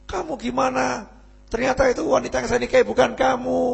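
high, hurried lovesick grievance; acted, high-pitched protest; plaintive complaint. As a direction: complain to the one who fooled you. Duped groom complains to the woman.

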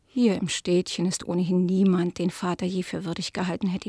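ordinary delivery

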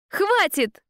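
sharp high bark; barked shout. Acted. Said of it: "рявкнула я".

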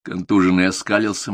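ordinary delivery

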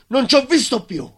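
explosive, very high, loud cry; furious outburst. Acted. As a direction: burst out in blind rage. Recalling the moment of rage.

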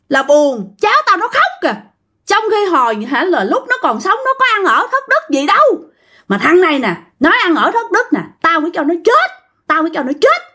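high, wounded complaint turning to threat; aggrieved old ghost. Retold or imitated. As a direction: lament the family, then vow revenge. Ghost laments family, threatens offender. (giọng hồn ma oán trách, lên cao rồi đe dọa)

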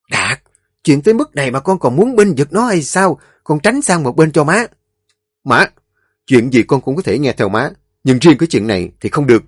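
loud, high fast arguing; mother and son quarrelling over the wife, angry; heated quarrel. Acted. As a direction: fight back hard.

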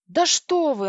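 overjoyed exclamation; delighted. A narrator's reading. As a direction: exclaim with glad surprise. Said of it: "обрадовалась я".

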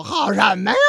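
a loud exasperated shout; mock shout. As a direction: yell it back as the fed-up father. Drunk father complains to police. (父亲冲警察嚷嚷)

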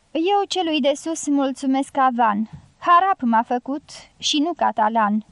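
plain reading voice

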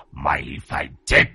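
low demonic shout, fading away; demonic double voice. A narrator's reading. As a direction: threaten like a demon. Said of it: "nói bằng cái giọng ma quỷ".

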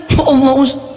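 high-pitched imitation of a man bursting into tears; mock tearful cry. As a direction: act out a tearful, pleading exclamation. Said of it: "Ini orang langsung nangis".

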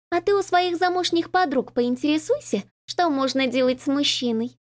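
high, teasing smirk in the voice. A narrator's reading. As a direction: tease her, amused. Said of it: "промолвила Ларин с усмешкой в голосе".

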